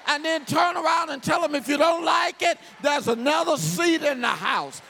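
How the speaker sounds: fervent pulpit shout; full-force preaching. Acted. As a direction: preach it loud to the church.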